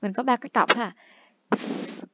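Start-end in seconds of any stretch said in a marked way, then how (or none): 1.6-2.0 S đọc như tiếng xì /s/ nhẹ; ending s sound. Shown as a demonstration. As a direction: hiss a soft s. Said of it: "chữ S này nè". (âm s vô thanh)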